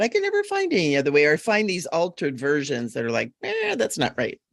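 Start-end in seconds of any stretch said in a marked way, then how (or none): 3.4-4.4 dismissive mock remark. Mocking wrong versions.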